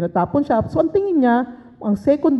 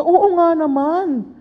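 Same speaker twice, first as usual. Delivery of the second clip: 1 mock agreement; 7 semitones higher, much slower than usual.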